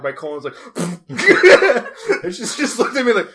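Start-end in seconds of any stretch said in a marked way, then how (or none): 0.6-2.2 big cackling laugh breaking out; loud burst of laughter. Real, not acted. Friends hear his loud cackling laugh.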